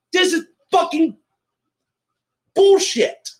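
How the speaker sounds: furious ranting shout; angry shout. Real, not acted. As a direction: yell it out in outrage. Outraged at the magnet sticking.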